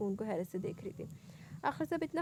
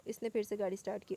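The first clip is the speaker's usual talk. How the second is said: ordinary delivery, nothing marked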